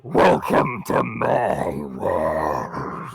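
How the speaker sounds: very low, drawn-out growl; menacing, very slow welcome from the shadows; ominous villain voice. Acted. Villain voice greets pulled-in city.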